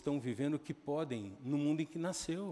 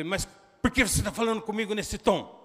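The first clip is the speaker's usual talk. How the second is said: acted angry shout; 6 semitones higher, 8 dB louder than usual. Father retold arguing with son.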